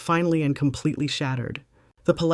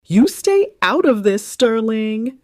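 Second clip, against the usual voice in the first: higher and louder, sharp furious mother; a mother snapping at her son to stay out; 8 semitones higher, 8 dB louder, slower than usual. Acted. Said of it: "Vivian snapped".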